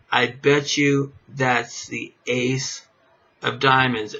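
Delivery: slow, deliberate bet on the card; slow confident bet. Shown as a demonstration. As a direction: draw out the bet to sell it. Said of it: "you're gonna tell somebody".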